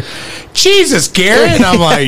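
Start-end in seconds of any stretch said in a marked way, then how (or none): 0.5-1.5 mock-exasperated mom, high on "Jesus". Mom reacting to her son farting, mock exasperated. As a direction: play the fed-up mom, lightly. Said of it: "just to make her go".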